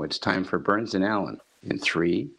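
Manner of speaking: ordinary talk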